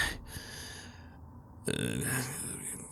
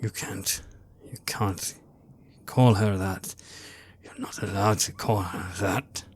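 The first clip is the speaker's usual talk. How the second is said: furious whisper; 2 semitones lower, 5 dB quieter than usual. Acted. Father's hushed outrage.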